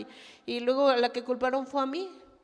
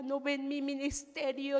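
ordinary delivery

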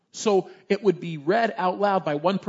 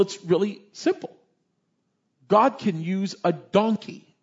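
ordinary delivery

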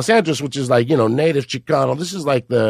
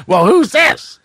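raised, slightly louder mock Leno echo; mimicking Jay Leno; 8 semitones higher, 4 dB louder, much slower than usual. Leno echoes the caller's question, mock Leno voice. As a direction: toss the question right back at him. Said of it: "he goes".

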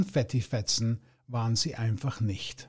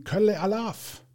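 higher, roaring carnival cheer; 7 semitones higher than usual. A narrator's reading. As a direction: bellow it like a partying crowd. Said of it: "brüllten". (brüllend, Karnevalsruf)

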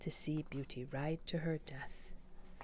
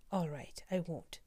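ordinary delivery, nothing marked

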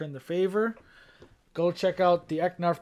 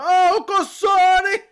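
high, loud, drawn-out holler; exuberant mock yell; 14 dB louder, much slower than usual. Friend imagines running naked through Rome's streets, exuberant yell. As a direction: belt it out, stretching the words.

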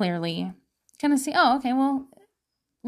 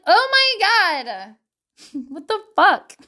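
mock outrage; 7 semitones higher, 4 dB louder than usual. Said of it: "you'd be like".

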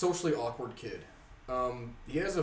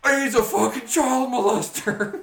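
much higher, louder, slow horrified yell; shocked outburst; 11 semitones higher, 12 dB louder, slower than usual. Retold or imitated. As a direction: drag out the realization in a stunned shout. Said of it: "and I'm like".

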